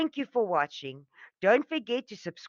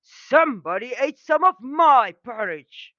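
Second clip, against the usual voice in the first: loud, higher angry bear roar; 7 semitones higher, 9 dB louder than usual. A narrator's reading. Daddy Bear finds his porridge eaten, roaring angrily. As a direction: bellow it like a furious father bear. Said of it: "Daddy Bear roared angrily".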